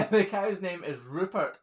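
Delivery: laughing. Real, not acted. Reading a viewer comment on the livestream, laughing. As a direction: read it out while cracking up.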